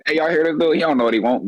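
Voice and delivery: ordinary talk, nothing marked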